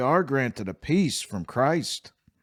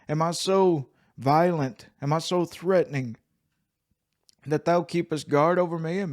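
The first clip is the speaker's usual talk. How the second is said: higher, rising plea to God; pleading scripture reading; 5 semitones higher than usual. Acted. Pastor reading Job's plea to God, higher and pleading. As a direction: ask God why, climbing in pitch.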